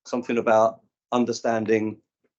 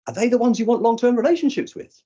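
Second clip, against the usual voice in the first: mock challenge; 12 semitones higher, 5 dB louder than usual. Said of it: "speaker mimics his own challenge".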